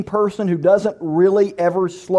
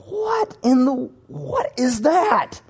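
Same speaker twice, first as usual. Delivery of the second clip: high, slow, drawn-out bewilderment; startled disbelief; 6 semitones higher, much slower than usual. Acted. Sleeper jolted awake by knocking at two A M, bewildered. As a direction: woken up baffled, stretch out each word.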